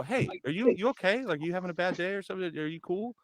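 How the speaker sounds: high, gentle voice checking on a student; concerned check-in. Retold or imitated. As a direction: act out a tentative, caring question. Retold careful check on weird student.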